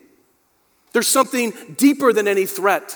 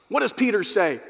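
usual speaking voice

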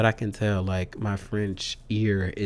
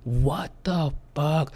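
slow, disbelieving what the fuck; stunned what the fuck; 4 semitones higher, slower than usual. Retold or imitated. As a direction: drag it out in shock. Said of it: "And then I was like".

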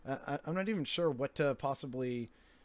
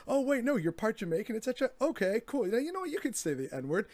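higher-pitched mock realization of them; imitating friends conceding; 6 semitones higher, faster than usual. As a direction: quote them backtracking, pitched up. Friends granting a pass.

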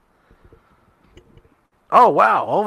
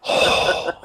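heavy wheezy exhale through the nose; imitated heavy breathing; 5 dB quieter than usual. Imitating Tony's cigar breathing, a wheezy heavy exhale. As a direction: breathe out like a heavy man straining. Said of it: "like the fat fuck".

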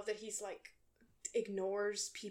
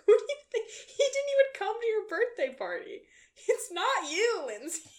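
exasperated rant; 11 semitones higher, 9 dB louder than usual. Real, not acted. Ranting at Lindsay.

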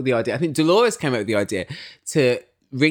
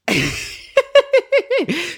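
high, loud mock cackle; imitated gleeful cackle; 6 dB louder than usual. Podcaster imitating Teresa's glee, high loud cackle. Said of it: "she was literally like".

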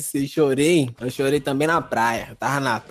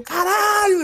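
much higher startled cry; mock terrified child; panicked yelp; much slower than usual. Kid terrified by mother.

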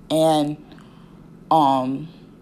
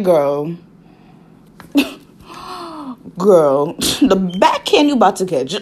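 laughing disbelief; 2 semitones higher, 3 dB louder than usual. Real, not acted. Vlogger laughing at how rude the nurse was, laughing.

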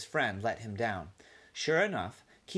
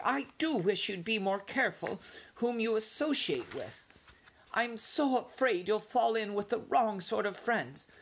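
high, worried plea of a mother mouse; lightly voiced anxious mother mouse; fretful mother mouse; 10 semitones higher than usual. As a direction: gently fret over your son's friends.

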